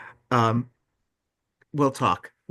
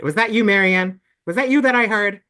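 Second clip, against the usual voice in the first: excited calling out; 7 semitones higher, 7 dB louder than usual. Real, not acted. Host spotting unmuted member.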